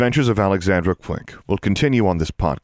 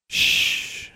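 whispered. Acted. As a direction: hush the bird gently. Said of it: "she whispered".

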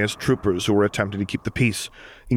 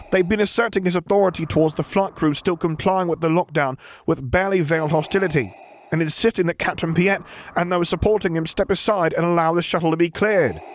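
higher, anxious junior officer; nervous officer voice; 9 semitones higher than usual. Acted. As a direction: answer like a jumpy subordinate. Officer reports in role.

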